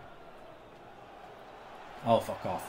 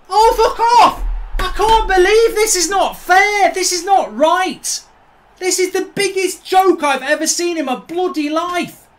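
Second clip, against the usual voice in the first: loud, higher raging outburst at the game; furious shout; 5 semitones higher, 6 dB louder than usual. Real, not acted. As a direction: yell it at the screen in real fury. Raging at losing FIFA match.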